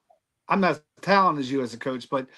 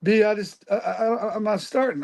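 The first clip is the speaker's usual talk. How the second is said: mock crying granddaughter; 6 semitones higher than usual. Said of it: "and she's crying".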